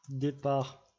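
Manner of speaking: French words said with a fake English accent; mock English accent. Tutorial host jokes with a fake English accent. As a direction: put on a silly English accent for the place name. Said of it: "avec un super accent qui ne veut rien dire".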